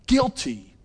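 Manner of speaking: high, ringing verdict word; verdict pronounced. Retold or imitated. God's verdict on the son: high pronounced judgment. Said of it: "God's verdict upon his son".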